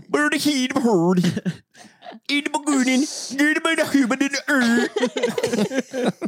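a hint of Norwegian in singsong nonsense; mock Norwegian-sounding gibberish. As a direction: babble scripture with a fake Norwegian lilt. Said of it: "This does sound kind of Norwegian".

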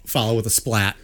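ordinary talk, nothing marked

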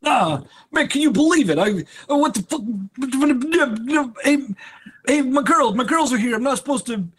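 put-on high-pitched, worked-up New York accent. Co-host mimics Matt's draft-night meltdown, exaggerated New York accent. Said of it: "it's all gonna be in a New York accent".

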